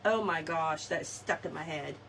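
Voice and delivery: ordinary talk, nothing marked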